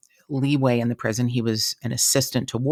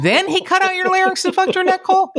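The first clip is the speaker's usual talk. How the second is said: much higher, louder mock repeat of the question; mock high-pitched repeat; 4 dB louder than usual. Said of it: "And I go".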